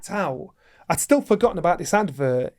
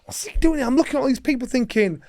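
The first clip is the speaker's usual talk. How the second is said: indignant thoughts; 9 semitones higher than usual. Retold or imitated. Angry inner thoughts, high pitched.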